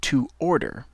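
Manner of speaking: "to order" clearly, falling; English model word. Shown as a demonstration.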